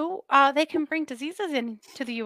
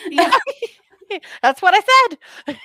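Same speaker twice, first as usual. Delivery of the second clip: laughing; 8 semitones higher, 11 dB louder, faster than usual. Real, not acted. Laughing at absurd story.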